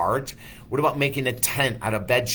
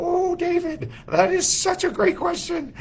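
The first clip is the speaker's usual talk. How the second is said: put-on very high, thin Indian-accented voice of a tiny old guru; Maharishi's Indian accent. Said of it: "He'd go".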